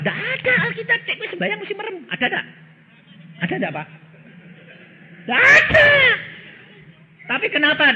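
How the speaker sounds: high, loud preaching challenge; excited preaching. Acted. Preacher challenging the congregation on closed-eye prayer. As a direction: fire the question at the crowd.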